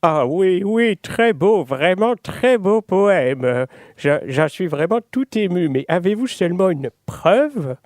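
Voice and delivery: high, slow gushing judge voice; pompous judge. Acted. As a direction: gush like a moved, pompous judge. Judge moved by the poem.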